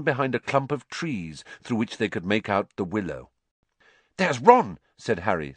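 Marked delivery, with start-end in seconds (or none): 4.2-4.8 higher, louder burst; sudden exclamation. Acted. Harry spotting Ron, sudden exclamation. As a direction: blurt it out.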